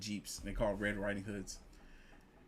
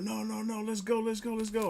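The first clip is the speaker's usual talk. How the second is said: much higher, pumped urging; mock eager; 10 semitones higher than usual. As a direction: push them on like hype men. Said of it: "They're like".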